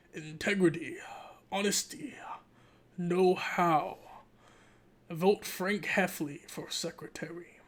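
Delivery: hushed, measured political ad voice; campaign ad read. Acted. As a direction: intone it slogan by slogan, with pauses.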